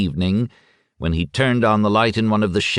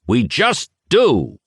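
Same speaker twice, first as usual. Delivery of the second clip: slow, louder and higher shout; 9 semitones higher, 4 dB louder, slower than usual. A narrator's reading. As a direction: bark the answer down at him. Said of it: "Yeremiash shouted at him".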